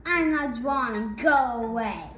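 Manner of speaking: sneering. Acted. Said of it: "Ramon sneered".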